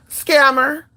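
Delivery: high, loud, drawn-out Scammer; sassy scammer. Real, not acted. Calling out a fake spiritualist, a loud sassy scammer. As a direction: throw the word like an accusation.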